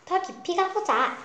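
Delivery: 높은 목소리로 조르듯; 떼쓰는 아이처럼 높게 졸라; 조르는 아이 목소리. Acted. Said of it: "똘똘이가 졸라댔어요". (높게 조르는 아이 말투)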